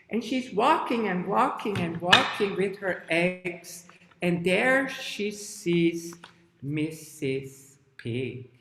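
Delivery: lilting, repetitive walking rhythm, slowing at the end; children's story narration. Shown as a demonstration. Teacher narrates hen's search, lilting then slow. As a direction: draw out the arrival of a character.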